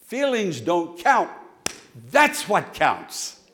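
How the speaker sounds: loud, emphatic preaching with punched words. Real, not acted. Preaches at full force.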